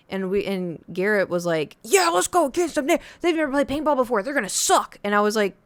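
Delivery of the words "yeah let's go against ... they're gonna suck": high, quick eager boast; a cocky kid brother, sped up and up high. Retold or imitated. Brother Garrett boasting to narrator, high and fast.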